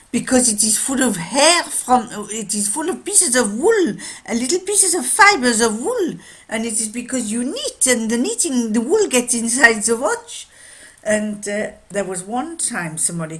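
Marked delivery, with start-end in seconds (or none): 0.1-10.5 put-on high, loud, excitable French accent. Watchmaker explaining wool in the watch, French accent. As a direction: reel off the proof in French-accented English. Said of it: "a French watchmaker".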